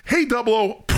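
high, breezy greeting; a light imitation of Felix; mock Felix greeting. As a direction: call him out cheerfully, high. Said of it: "He goes".

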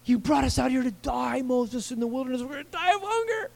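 high, soft whiny lament; whining Israelites. Acted. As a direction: whimper it like starving complainers. Israelites whining about hunger.

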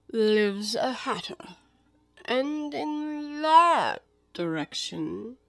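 very slow, long-held vowels; Cheshire Cat. Acted. The cat shows Alice both directions, languid.

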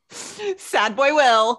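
slow drawn-out laughing label. Real, not acted. Laughing as she names the sad doctor. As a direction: savor the nickname through a laugh. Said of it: "slow laughing label".

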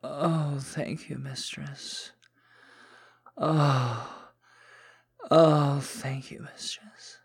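loud, high, breathless moans; submissive moaning. Acted. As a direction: moan gratefully and submissively. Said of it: "the more submissive side of me".

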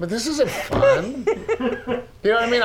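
high, slow, laughing whine; hammed-up pitiful whine; mock sad widower. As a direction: pout like lost without her. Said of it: "I'll be like".

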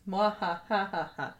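slow hammed-up villain cackle; mock evil laugh, drawn out. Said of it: "I wouldn't be a very good villain".

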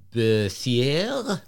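Berthier with a French accent, no final r. Shown as a demonstration. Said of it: "I got to say it with the French accent".

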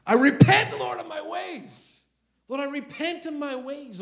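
high, strained cry of repentance, re-enacted; high-pitched pleading prayer, acted out; retold screaming prayer. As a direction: cry out to God, bursting then pulling back in volume. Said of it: "I literally screaming in my car".

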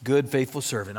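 low, slow, gentle approval; tender praise. Retold or imitated. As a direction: say it like a loving master. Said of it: "we wanna hear him say".

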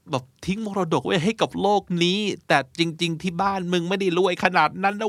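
high-pitched, incredulous ribbing of a friend; mock exasperated telling-off. Acted telling-off of artistic friend. (แกล้งบ่นเพื่อนเสียงสูงแบบเหลืออด)

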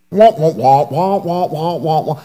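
Peanuts teacher wah-wah voice; muffled trombone-like adult wah wah; muffled wah wah talk. Pretend. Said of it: "I'm talking like the teacher in Peanuts".

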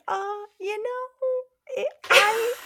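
high, slow, flustered stammer; squeaky panicked stammer; flustered high squeak. Retold or imitated. Stuck, flustered retold reply.

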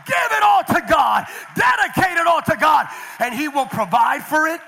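loud, high, shouted sermon climax; rally the church with big shouted declarations; preaching at full force. Acted. Pastor rallying parents to dedicate everything to God, shouted preaching.

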